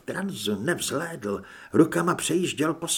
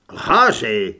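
loud, low angry shout; furious roar; 5 semitones lower, 8 dB louder than usual. A narrator's reading. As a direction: roar the accusation in fury. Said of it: "zařval vztekle Carlos". (vzteklý hluboký řev)

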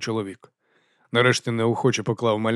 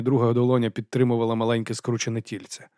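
plain reading voice

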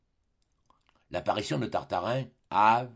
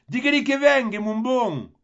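much higher, louder Marseille call; Marseille cry; 12 semitones higher, 8 dB louder than usual. Acted. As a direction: shout it down from the gallery in Marseille style. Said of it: "cria la Mauresque".

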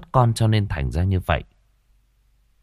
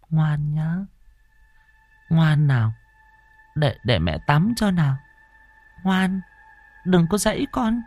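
put-on murmuring to a baby; 9 semitones higher than usual. Sleepwalking mother soothing an imagined baby, cooing. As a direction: coax a baby gently, far away. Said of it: "mẹ cứ lẩm bẩm".

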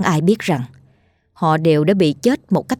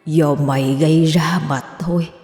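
menacing ghostly growl. A narrator's reading. Dead brother summoning his killer below, menacing. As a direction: draw out the threat like a ghost. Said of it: "gằn giọng thì thầm".

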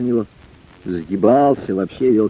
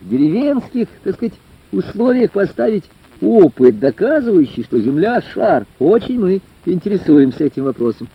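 raised, higher-pitched voice, speaking up for the hall; loud public question; 7 semitones higher than usual. A narrator's reading. Ringleader lad questions the lecturer from the front row, loudly. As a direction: put the question up loudly and deliberately. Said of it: "произнёс очень громко".

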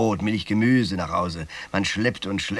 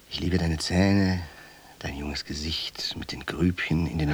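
soft, lingering words with long pauses; tender love declaration; 3 semitones lower, 3 dB quieter than usual. Acted. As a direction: confess love quietly, savouring each phrase. Liebeserklärung an Rita vor Sprung. (leise, zärtliche Liebeserklärung)

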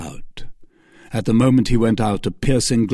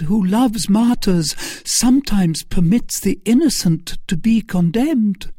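high, loud impassioned protest; pleading martyred lady; 12 semitones higher, 4 dB louder than usual. Acted. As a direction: plead innocence before God, pitched high. Narrator plays pleading Milady.

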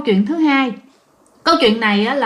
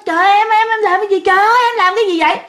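panicked cry; 9 semitones higher, faster than usual. Retold or imitated. Husband panics seeing wife bleeding.

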